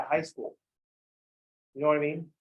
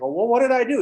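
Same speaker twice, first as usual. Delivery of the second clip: confused student; 6 semitones higher, 7 dB louder than usual. Retold or imitated. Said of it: "It's like".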